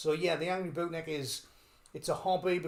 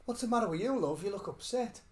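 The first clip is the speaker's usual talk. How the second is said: put-on kindly old fella; 5 semitones higher than usual.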